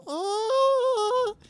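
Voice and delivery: put-on very high baby cry; tiny high newborn sound; baby's squeal. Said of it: "they just go".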